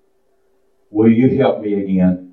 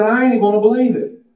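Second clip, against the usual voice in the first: a hint of stubborn Thomas, higher and fast; quick, lightly voiced refusal; doubting Thomas; 11 semitones higher, faster than usual. Preacher voicing Thomas refusing to believe, higher and fast. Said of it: "he said".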